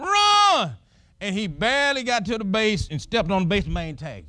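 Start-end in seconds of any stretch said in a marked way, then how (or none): 0.0-0.7 high shout of run. Retold or imitated. Crowd yelling at the runner, high shout. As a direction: holler it across the field.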